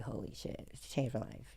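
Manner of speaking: soft, low retold amazement; hushed realization. Client struck by therapist's advice, hushed amazement. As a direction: let it out low, almost to yourself.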